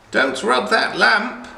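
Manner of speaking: high, loud shout; shouted warning. Acted. Friendly witch warns Aladdin, shouting high and loud. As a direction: yell to stop him in time. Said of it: "shouted the friendly witch".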